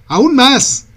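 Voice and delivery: high, loud, angry yell; furious skipper shouting at the helmswoman. A narrator's reading. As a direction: snap the order in rage. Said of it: "grita, y Alida siente que hay rabia en su voz".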